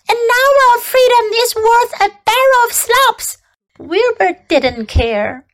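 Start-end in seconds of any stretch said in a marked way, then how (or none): 0.1-3.4 high, insistent goose voice; honking goose. A narrator's reading. Goose argues for freedom to the pig, honking. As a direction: honk out the argument, pitched high. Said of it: "honked the goose".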